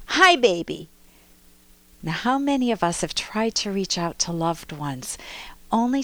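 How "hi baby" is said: put-on loud, hurt, cross "Hi, baby"; hurt and angry toddler. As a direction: snap it out, wounded and angry. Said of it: "to hurt and anger".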